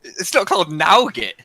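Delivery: high, loud, slow disbelief; can't believe it, drawn out; incredulous. Real, not acted. Arguing over nougat pronunciation.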